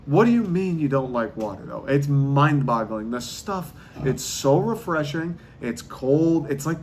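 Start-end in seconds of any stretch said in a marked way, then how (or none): none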